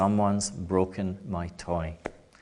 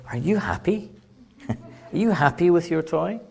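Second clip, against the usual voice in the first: put-on high, sing-song grown-up asking a small child; grown-up checking on child; 7 semitones higher than usual. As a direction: coo at a toddler, much higher. Adult asks child about toy.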